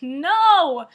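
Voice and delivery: high, loud whiny no; mock protest. Student protesting teacher's teasing, loud mock whine. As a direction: protest in exaggerated annoyance. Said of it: "and I was like".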